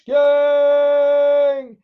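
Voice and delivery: high, loud, hugely drawn-out rallying cry; slow, stretched hype bellow; slogan shout. Acted. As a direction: belt each word on one long held note.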